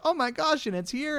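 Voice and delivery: high, soft delighted gasp; excited priest, high and amazed; imitating delighted priest. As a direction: mimic a giddy YouTuber. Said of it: "he was just like".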